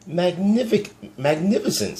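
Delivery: high, loud delighted gasp of praise; excited praise. Acted. Elizabeth marvelling at the dragon, thrilled and high.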